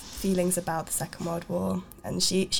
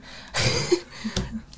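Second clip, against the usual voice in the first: embarrassed laugh; 10 semitones higher than usual. Real, not acted. Laughing at mic mishap.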